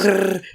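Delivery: rolled brrr of a flying ship; imitated engine noise. Describing flying gunships to a co-host, buzzing brrrr.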